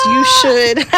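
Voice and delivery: high, loud, eager "you should"; excited. Retold or imitated. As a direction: burst out eagerly. Quoting herself, high and loud.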